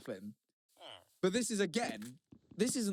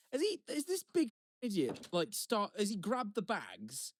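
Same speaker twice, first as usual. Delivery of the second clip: higher-pitched exasperated inner rant; frustrated; 5 semitones higher than usual. Retold or imitated. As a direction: retell your irritated disbelief, voice raised in pitch. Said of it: "I'm getting a bit frustrated".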